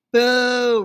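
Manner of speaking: loud crowd boo; crowd roaring boo. Acted. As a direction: roar it like a hostile crowd. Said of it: "roared the crowd".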